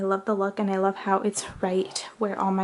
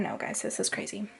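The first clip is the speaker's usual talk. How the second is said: whisper; 8 dB quieter than usual. Real, not acted. Overwhelmed aside about messy room.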